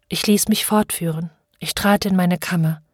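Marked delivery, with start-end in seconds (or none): none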